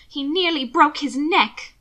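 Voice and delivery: sharp concern. Acted. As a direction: cut with worried sharpness. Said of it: "concern sharpening her voice to a nice edge".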